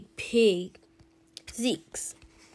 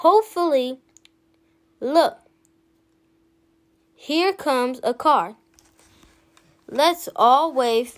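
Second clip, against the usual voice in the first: louder, eager calling out; hopeful excitement; 3 semitones higher, 4 dB louder than usual. Acted. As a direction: spot the car and rally everyone. Family spots a car.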